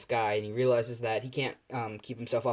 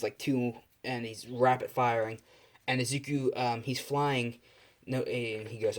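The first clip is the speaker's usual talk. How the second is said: ordinary delivery, nothing marked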